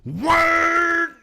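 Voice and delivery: high, loud revving vroom; imitated engine roar. Both drivers stomp the gas, imitated engine roar. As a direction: screech like an engine at full throttle.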